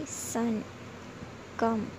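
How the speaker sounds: short अ sound in सन and कम; short vowel. Shown as a demonstration. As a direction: keep the vowel brief in सन, कम. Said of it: "ह्रस्व उच्चारण". (सन, कम में ह्रस्व अ)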